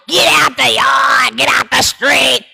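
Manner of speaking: retold mom shouting at kids in the street; imitating mama yelling. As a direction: play the mama hollering at the kids. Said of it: "The Baps was like".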